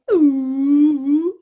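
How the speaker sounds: high loud whale-like hoot; whale call. Shown as a demonstration. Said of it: "Do a little, like".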